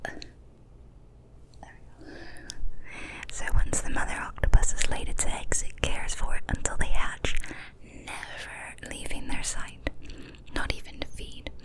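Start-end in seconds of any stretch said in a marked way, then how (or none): none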